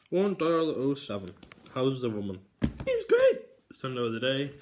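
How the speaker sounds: loud, high ranting; shouting. Pretend. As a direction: yell it full tilt. Shouting nonsense.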